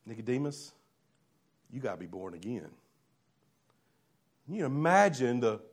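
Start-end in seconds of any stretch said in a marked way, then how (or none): 0.0-2.7 quiet Jesus. Acted. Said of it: "And Jesus says".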